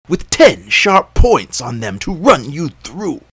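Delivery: loud fake monster roar; girl pretending to be a huge beast; deep fierce voice. A narrator's reading. Girl scaring the jackal off, loud faked fierce monster voice. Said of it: "made her voice as deep and as fierce".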